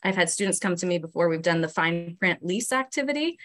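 ordinary talk, nothing marked

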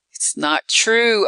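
high, drawn-out protest; acted shout. A narrator's reading. Victim denying Eve's revelation, acted shout. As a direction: cry out in denial, not full volume. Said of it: "I shouted".

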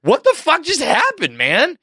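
very high, slow incredulous question; hammed-up bafflement, pitch soaring; bewildered disbelief. Onlooker reacting to the hammer attack, bewildered. Retold reaction to the bizarre incident.

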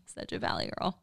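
usual speaking voice

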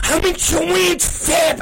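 high, strained exasperated cry; retold angry yelling. Dad telling callers where he is, exasperated high yelling. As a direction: protest it in a high mock yell. Re-enacting his angry phone rant.

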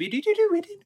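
high TV computer beeping; computer beeps. Retold or imitated. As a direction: chirp like an old TV computer. Computer sound imitation.

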